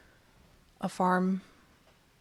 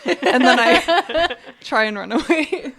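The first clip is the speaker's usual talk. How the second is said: higher, louder, laughing through words; 6 semitones higher, 7 dB louder, slower than usual. Real, not acted. Player telling the table of the escape, laughing. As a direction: let real laughter break up the sentence.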